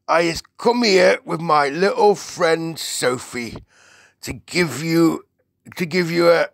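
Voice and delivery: high, loud giant voice, halting at the end; eager giant. Acted. BFG addresses the Queen, eager, then hesitant. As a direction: announce it grandly, then falter for a word.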